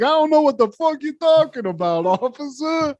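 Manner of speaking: mock wiseguy. Johnny answers the cop, high mock-innocent voice. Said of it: "He's like".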